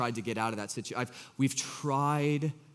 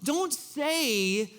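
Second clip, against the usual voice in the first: mock retort; 12 semitones higher, much slower than usual. Mocking the fake believer.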